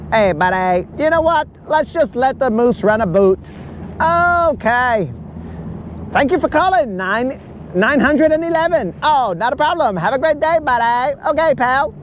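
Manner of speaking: a sing-song Canadian phone operator, all politeness; playful Canadian hoser voice; mock Canadian accent. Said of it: "a normal nine one one situation with the Canadians".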